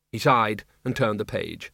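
ordinary delivery

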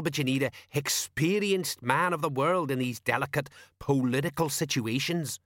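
pompous know-all sergeant; Colon. Acted. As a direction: lecture like a man of the world.

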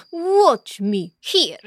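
slow gloating stepmother; sneering stepmother. Acted. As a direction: drawl it with a sneer. Stepmother mocking.

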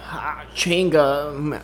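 slow mock gringo-Mexican Spanish; American mangling Mexican Spanish; gringo accent. Comedian plays head-trauma patient, thick gringo accent. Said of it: "como un gringo hablaría español".

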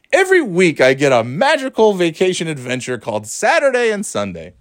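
high, swooping fake excitement; hammed-up high-pitched wonder; mock-grand sarcasm. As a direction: sell it like a wondrous holiday, tongue in cheek. Mock-grand billing of the weekend.

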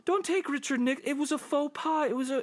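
high, squeaky mock pleading; pleading public. Imitating everybody defending Nixon, high mock pleading. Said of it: "everybody was like".

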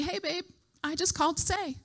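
mom's sweet little voice. Retold or imitated. Mom calling her daughter to chat, sweet little voice. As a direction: imitate a mother, small and sweet. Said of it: "mom's sweet little voice".

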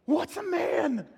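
high, slow, stretched-out question; hammed-up baffled angel; mock wonder. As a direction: marvel cluelessly, drawing out the words. Angels baffled by new creation.

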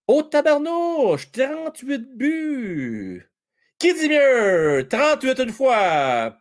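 high, slow, drawn-out auctioneer calls. Pretend. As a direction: play the auctioneer, stretching each bid. (crieur d'encan exagéré, aigu et lent)

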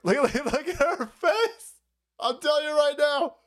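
ordinary talk, nothing marked

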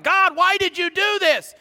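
bold, high-pitched cry at God; bold challenge. Retold or imitated. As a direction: act out boldly confronting God. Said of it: "not maybe as bold as Joshua".

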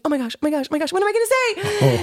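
high panicked inner voice. Retold or imitated. To herself before the robbers, high mock panic.

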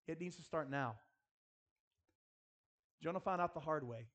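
usual speaking voice

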